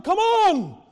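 high, loud team shout; yelled order. Retold or imitated. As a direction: bark it across the field like a captain. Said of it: "yelling orders".

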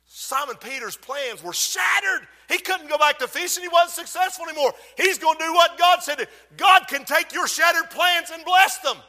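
high, driving pulpit shout; full-force preaching. Real, not acted. Preacher urging the church about shattered plans, full-force preaching. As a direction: drive each sentence home to the congregation.

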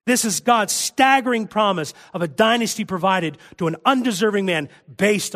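high and loud, pressing each point home; fervent preaching. Acted. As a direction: preach it at full force to the congregation. Preacher swells into hymn words.

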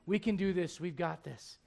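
low, soft, steady reassurance; mustered courage. Retold or imitated. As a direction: reassure a panicking wife quietly. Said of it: "all the courage".

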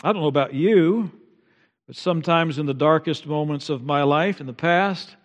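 usual speaking voice

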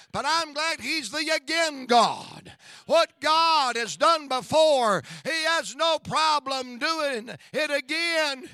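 rhythmic rising calls to the congregation; preaching. Acted. Pastor rousing the congregation about the God who does it again, fervent preaching. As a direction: preach it out, building with each repeat.

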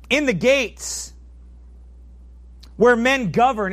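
fiery preaching. Acted. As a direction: hammer home the gates to the listeners. Preaching on street evangelism.

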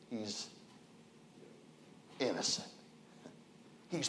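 almost breathe the word innocent; hushed. Acted. Hushed climax of sermon.

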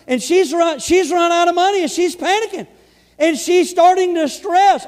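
loud, high, building preacher's cry; preaching at full force. Acted. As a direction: drive the story up to a fevered pitch. Preacher builds story to climax.

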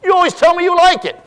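hurt wife. Retold or imitated. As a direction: throw it back at him like the stung wife.

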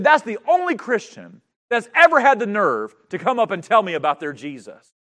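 furious preacher's retelling; retold angry outburst. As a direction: bellow it like the angry magician. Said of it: "he got angry".

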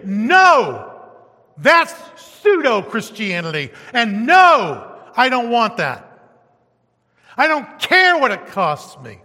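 loud, firm no to false faith; firm refusal. Acted. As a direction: declare it as a believer standing firm. Preacher voicing a firm believer.

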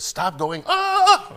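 high, loud panicked plea ending in a shriek; hammed-up wife terrified on a roller coaster; mock frightened wife. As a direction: play the scared wife begging the ride to stop.

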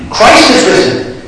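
ordinary talk, nothing marked

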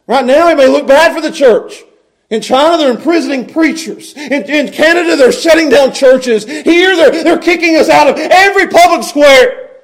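high, building preacher's fervor; impassioned preaching. Real, not acted. Preacher to congregation on the returning angels, impassioned. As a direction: preach it out, swelling on the big words.

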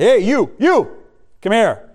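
imitating a sharp call to a passerby. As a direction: hail a stranger abruptly, as a retold command. Acting someone stopping Simon to order him.

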